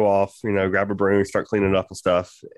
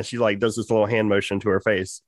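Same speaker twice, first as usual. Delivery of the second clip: usual speaking voice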